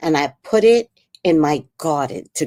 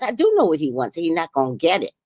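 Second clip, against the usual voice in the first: normal talking voice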